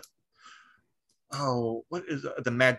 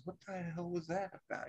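higher, much softer bewildered mutter; half-whispered what-was-that, pitched up; baffled aside; 7 semitones higher, 14 dB quieter than usual. Retold or imitated. Host retelling a friend's confused reaction after the skit, a soft baffled mutter.

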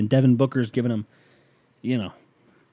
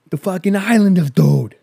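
put-on Boston accent shouted high and loud; bad Boston accent; 7 semitones higher, 8 dB louder than usual. Podcaster imitating crazy Islanders fans, loud bad Boston accent. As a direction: bellow it like a rowdy Boston-accented superfan. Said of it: "I can't do it. My voice hurts".

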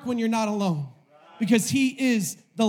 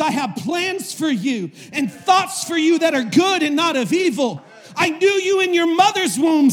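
building to a loud, higher peak; impassioned proclamation; 4 semitones higher, 5 dB louder than usual. Acted. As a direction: proclaim it with rising force. Preacher voices God's promises to congregation.

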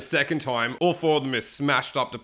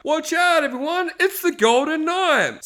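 high, slow, grand hero's call; heroic announcement; 13 semitones higher, 3 dB louder, slower than usual. Retold or imitated. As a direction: announce yourself like a comic hero. Hero announcing his charge.

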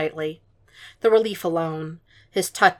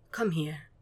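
very quiet, gentle invitation; hushed and coaxing; soft coaxing; 9 dB quieter than usual. A narrator's reading. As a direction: coax softly, barely raising the voice. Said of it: "Hannibal coaxed softly".

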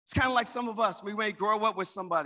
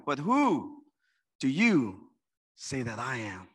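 WHO and YOU hit hard, each set apart by a pause; weighty pointed question; 10 semitones lower, slower than usual. Acted. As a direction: land on YOU, then pause. Preacher voices Jesus' pointed question.

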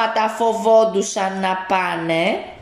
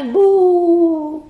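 high, loud ghostly boo; 7 semitones higher, 5 dB louder than usual. Acted. Little ghost in the story tries a loud boo. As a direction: belt out a scary ghost boo.

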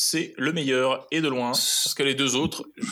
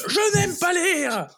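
mock protest; much slower than usual. Mock whiny protest about reading.

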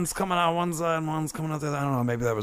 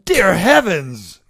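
slow, loud horrified cry; shocked old-timey gasp; 3 semitones higher, 9 dB louder, slower than usual. Acted. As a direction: recoil at the sight. Character shocked in scene.

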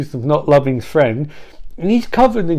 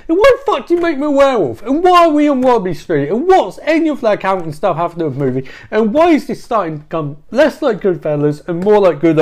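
high, fast outraged yelling; Bobby losing it, rattling off complaints; mock furious rant; 7 semitones higher, faster than usual. Bobby ranting at Eve, high and fast outrage.

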